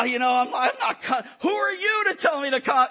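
loud, high, indignant rant; hammed-up indignant outburst; riled up. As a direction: fire back like you've been told to calm down. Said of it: "when I'm all riled up".

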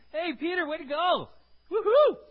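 loud, high excited cheer. Acted. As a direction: cheer Peter on like a proud coach. Preacher voicing Jesus cheering Peter.

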